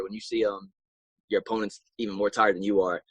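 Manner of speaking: usual speaking voice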